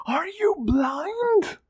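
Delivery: high, slow, cracking shriek; shrieking in despair. A narrator's reading. The fallen king shrieks at the heroes, high and slow. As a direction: wail it out like a broken king. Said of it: "Calor shrieked".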